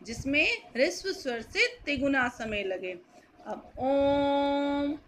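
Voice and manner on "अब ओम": ओम sustained very slowly, the vowel held long; long chanted ओम; prolonged vowel. Shown as a demonstration. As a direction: stretch the ओ in ओम far out. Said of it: "आम का उच्चारण कर रहे हैं".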